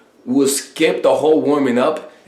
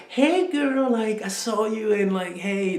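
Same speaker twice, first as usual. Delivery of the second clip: high, soft, meek voice; exaggerated high-pitched wimpy hesitance; timid mock approach; 8 semitones higher, 6 dB quieter than usual. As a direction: mimic a nervous guy shyly saying hello. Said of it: "It's almost like you're not like".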